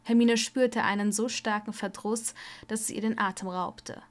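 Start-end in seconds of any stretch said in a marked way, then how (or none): none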